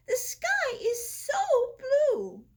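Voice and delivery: Tulip's high voice. A narrator's reading. Tulip marvels at the day.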